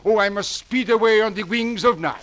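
put-on panicked French accent; frantic French dandy rushing off.

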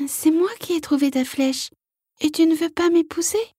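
high, small hurt frog voice; very small voice, close to tears; tiny voice. Acted. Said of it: "d'une toute petite voix".

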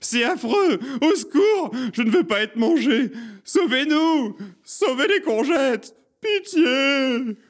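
very high, loud cries for help; panicked screaming. A narrator's reading. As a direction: shriek like a crowd in terror. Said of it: "se mirent à hurler à nouveau, à sangloter".

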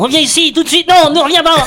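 high, loud desperate yelling; frantic shout. Acted. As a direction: scream after a fleeing dog. Master yelling at fleeing dog.

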